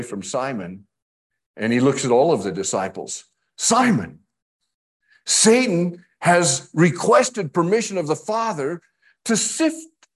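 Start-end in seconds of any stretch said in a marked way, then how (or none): 3.6-10.1 emphatic quote. Acted.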